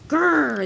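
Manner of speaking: loud, grumbling growl. Acted. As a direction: growl in protest at an order. Trucks growl at being given work.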